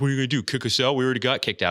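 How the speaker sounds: mock defiance. Kicked-out kids taunting the owner, loud mock defiance. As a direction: imitate cocky kids daring the boss. Retold kids' defiant retort.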